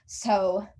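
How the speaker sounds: "So" held open, expecting an offer; trailing off. Acted. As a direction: leave "So" hanging pointedly. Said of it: "I trail off in that".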